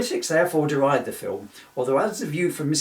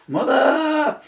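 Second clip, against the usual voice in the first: mock shriek; 13 semitones higher, 8 dB louder than usual. Exclaimed outburst.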